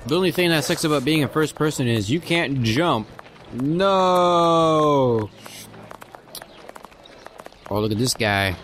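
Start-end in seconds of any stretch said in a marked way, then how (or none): 3.5-5.3 long, high drawn-out "no"; mock wail. Player lamenting that you can't jump, mock wail. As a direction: draw it out in mock despair, pitched up.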